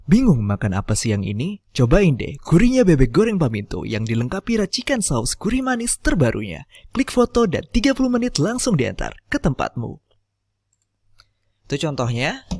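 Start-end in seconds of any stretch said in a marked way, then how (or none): 0.1-10.0 upbeat, louder promo voice climbing much higher; ad read. Acted. Tutor demos a food delivery ad script, energetic promo read. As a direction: sell the fried duck like a radio spot.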